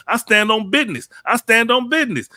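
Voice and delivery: high, loud imitation of a young boaster; mock serious insistence. Host retelling a rapper's son turning serious, high loud mock insistence. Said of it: "he started getting a little more serious".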